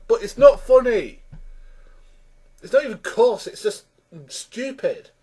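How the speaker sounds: high-pitched exasperated complaint. Real, not acted. Reviewer dismissing the sketch, exasperated. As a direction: complain in disbelief, pitch lifted.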